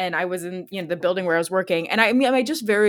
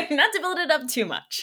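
laughing through the words; 10 semitones higher, 5 dB louder than usual. Real, not acted. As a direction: giggle while teasing the ending. Retake said while laughing.

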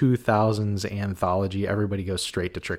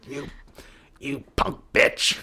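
slow, growled mock insult; lightly voiced slow Busey taunt; Gary Busey impression; 4 semitones higher than usual. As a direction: channel Busey as the Ginger Dead Man, slowly. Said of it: "channel my Gary Busey".

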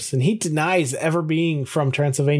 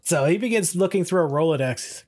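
ordinary talk, nothing marked

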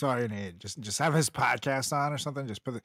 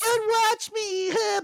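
whiny mock celebrity. Mocking celebrity couple.

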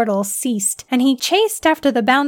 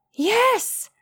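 much higher, exultant yes; triumphant cry; 13 semitones higher than usual. A narrator's reading. Scientist cheers as her trap catches the leprechaun, triumphant. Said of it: "a female voice echoed".

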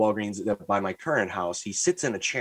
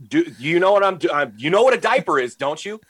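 higher, louder exasperated questioning; annoyed; 6 semitones higher, 4 dB louder than usual. Retold or imitated. Customer to clueless Target kid about diapers, annoyed. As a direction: spell it out, incredulous and annoyed. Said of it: "I was so, I was so annoyed".

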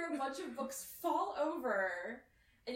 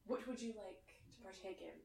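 quiet, mock-refined question; hushed posh offer; 3 semitones lower, 13 dB quieter than usual. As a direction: offer it like a fancy sommelier. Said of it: "he's like".

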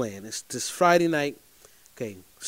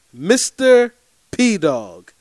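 stretched out slowly, a bit louder; slow drawn-out quote; 4 semitones higher, 6 dB louder, much slower than usual. Retold or imitated. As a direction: drag out the nickname teasingly. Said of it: "She says these words verbatim".

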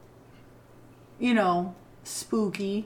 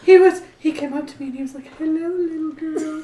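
higher, quick mock retelling that breaks into a laughing cry; mimicking Phoebe Cates; 7 semitones higher than usual. Host imitates Phoebe recalling the flasher, fast and laughing. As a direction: rush through her creepy story, a bit higher. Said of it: "She's like".

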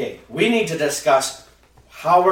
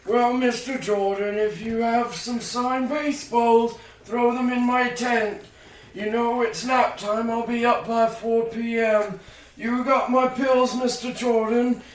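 put-on higher, softer, slow old-man voice; a sleepy grandpa rambling about pills; old grandfather; 4 semitones higher, 5 dB quieter than usual. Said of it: "the grandfather keeps talking".

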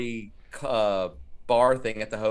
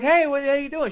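lifted, much higher friendly greeting; imitating a chirpy star saying hi; higher, louder mock of a friendly actress; high bright greeting; 14 semitones higher, 3 dB louder than usual. Quoting Linnea Quigley again.

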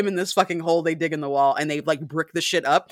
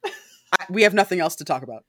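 haughty gasp then fast brush-off; mock indignant Louis; 3 semitones higher, 6 dB louder than usual. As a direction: act offended, cut him off quickly. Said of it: "And Louis is like".

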